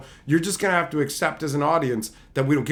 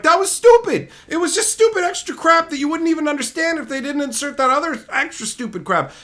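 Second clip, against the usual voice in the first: much higher, louder disgusted yell; exasperated outburst; 12 semitones higher, 6 dB louder than usual. Retold or imitated. Telling Carly the scene was stupid, loud and exasperated. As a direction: blow up at how dumb it was. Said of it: "I'm like".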